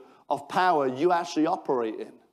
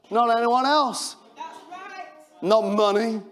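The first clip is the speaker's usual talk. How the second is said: preaching at full force; 4 semitones higher than usual. Real, not acted. Preacher declaring to the congregation, shouting.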